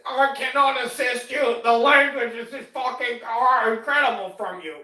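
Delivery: high, loud, indignant Karen; shrill scolding entitled manager-demander. Retold or imitated. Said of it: "full-blown Karen two point oh mode".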